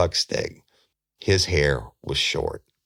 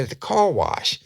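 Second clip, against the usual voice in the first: excited. Retold or imitated. Excited suspect answers.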